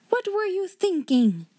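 high-pitched outraged demand; Miss Minchin demanding. A narrator's reading. Headmistress repeating her question, high outraged demand. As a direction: pitch it right up, scandalised. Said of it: "demanded Miss Minchin".

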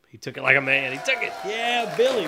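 put-on loud, rising cheer for a tough toddler. Crowd cheering little Billy after his fall, loud and excited. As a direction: whoop him up like a proud crowd.

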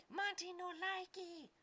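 very high, slow squealing monkey voice in broken English; yelling little monkey. Acted. Trapped monkey Monty yells at Artius about his knife, in a very high monkey squeal.

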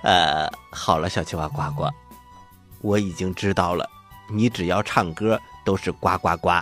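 ordinary talk

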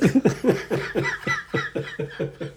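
airy high-pitched laugh; high breathy laugh. Real, not acted. After cohost's bar joke, wheezing laugh.

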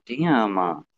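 usual speaking voice

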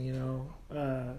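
ordinary delivery

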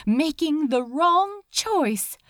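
usual speaking voice

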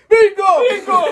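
high, loud shout of bingo; mock winner's shout in a bingo hall. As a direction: yell it out like a winning player.

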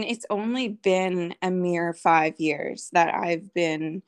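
ordinary talk, nothing marked

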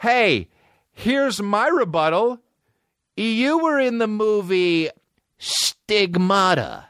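high, slow, sneering comeback; mock rebuttal. Real, not acted. Host answering Patricia Arquette's speech with a slow sneer. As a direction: drag out the rebuttal with scorn.

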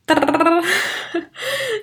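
short real giggle; a quick breathy laugh before the reveal. Real, not acted. Laughing at her own drum roll.

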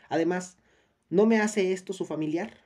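insistent. A narrator's reading. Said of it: "continuó".

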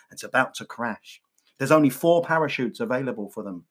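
ordinary delivery

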